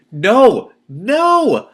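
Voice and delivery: loud, high desperate No!; panicked cry. Acted. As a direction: scream No! as teeth close in. Heroine about to be eaten.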